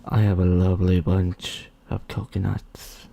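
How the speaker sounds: low slow silly voice; funny voice. Pretend. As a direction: drag it out in a goofy low voice. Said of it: "I could say that so many different ways".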